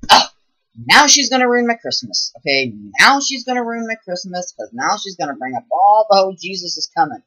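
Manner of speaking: high, loud whiny complaint; mocking family member who dreads the Jesus talk; mocking annoyed relative. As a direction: whine like a fed-up family member at Christmas. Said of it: "they're like".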